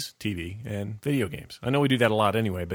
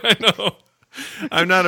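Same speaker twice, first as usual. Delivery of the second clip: laughing; 8 semitones higher, 6 dB louder than usual. Real, not acted. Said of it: "You sound like a Muppet".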